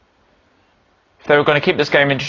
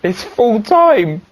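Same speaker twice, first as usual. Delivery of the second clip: drawn-out call; 6 semitones higher, much slower than usual. Acted. Full time called twice.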